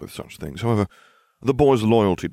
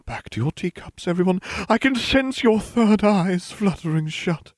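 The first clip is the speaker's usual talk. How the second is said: mystic seer; 11 semitones higher, 4 dB louder than usual. A narrator's reading. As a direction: intone it like a theatrical fortune-teller. Trelawney lectures the class.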